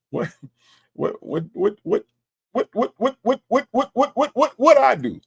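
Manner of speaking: rapid high repeats, climbing; mock-innocent stammer. Host answering Miss H L S, comically flustered.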